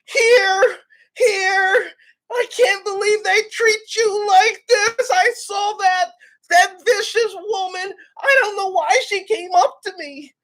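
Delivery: put-on high, tender old man voice; sympathetic old man. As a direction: comfort her like a doting old man. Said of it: "That old man was like".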